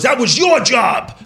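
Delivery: slow, high-pitched mock reproach; scolding father. Dad blaming son after the beating, imitated slow scolding. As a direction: drag it out as an imitated angry dad.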